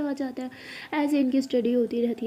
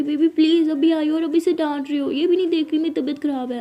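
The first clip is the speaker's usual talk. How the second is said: higher, louder lover's complaint; pleading protest; 4 semitones higher, 6 dB louder than usual. Acted. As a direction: plead indignantly against being scolded. Sick partner protests at being scolded.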